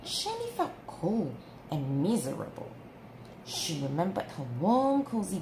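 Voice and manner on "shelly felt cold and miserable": ordinary delivery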